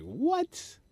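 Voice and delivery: high rising mock surprise; amazed what. Comic amazed at a packed snowstorm show, high squeak. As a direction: squeak it in disbelief. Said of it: "I was like".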